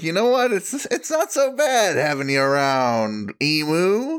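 high-pitched, playful change of heart; acted-out grudging acceptance; mock conceding. Bar staff warming to emu.

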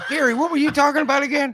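high, loud, fast dismissive wife; mocking wife. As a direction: brush him off, distracted by Gary. Mocking wife ignoring him.

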